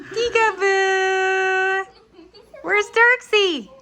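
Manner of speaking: high, loud, slow peekaboo call; excited peekaboo. Pretend. Mother playing peekaboo with baby, high and loud. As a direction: sing out peekaboo to make the baby laugh.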